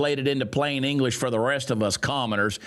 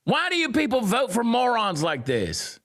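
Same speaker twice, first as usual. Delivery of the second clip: higher, incredulous jab; exasperated; 9 semitones higher than usual. Real, not acted. Scolding voters in a high, incredulous voice. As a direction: ask it in high disbelief.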